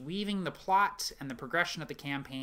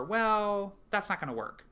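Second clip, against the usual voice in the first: hedging refusal; 7 semitones higher, 4 dB louder than usual. Acted. Dungeon master stalling before turning down a player's idea, hedging. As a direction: stall on a long, raised word.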